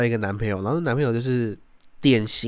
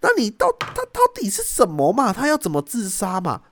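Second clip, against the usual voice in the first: high, impatient demand, much higher; imitating the sister; 9 semitones higher than usual. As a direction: press her for the answer. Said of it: "然后我姐就说". (模仿姐姐不耐烦地追问)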